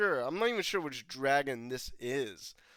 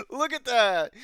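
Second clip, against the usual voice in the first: high laugh breaking into the words; giddy delighted laughter; 9 dB louder than usual. Real, not acted. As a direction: laugh as you point at it. Laughing at the sapphire eye.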